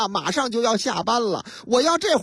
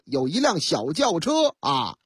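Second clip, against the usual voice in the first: ordinary delivery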